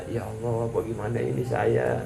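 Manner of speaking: a soft, hushed cry to God; quietly begging forgiveness; humble quiet plea. Retold or imitated. Prostitute pleading with God, hushed and humble.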